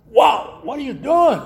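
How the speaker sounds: jolted, loud protest at the clumsy pourer; startled outburst. Retold or imitated. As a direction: snap it out like hot coffee just hit you. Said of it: "Now, I would probably say".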